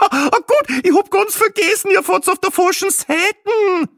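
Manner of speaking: very high, loud Austrian woman laughing; hysterical laughter. A narrator's reading. Passenger realises she's on the wrong side, laughing hysterically. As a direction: shriek it through giggles, stretching the end. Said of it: "ein geradezu hysterisches Lachen".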